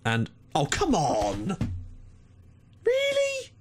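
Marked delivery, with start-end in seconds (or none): 2.9-3.5 shrill disbelieving yelp, way up high; can't believe what he just saw; outraged disbelief at the goalkeeper; incredulous. Real, not acted. Furious at conceded free kick.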